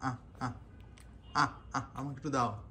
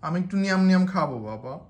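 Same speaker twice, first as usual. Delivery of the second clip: playful pleading; 7 semitones higher, 9 dB louder than usual. Pretend. Playful begging to baby.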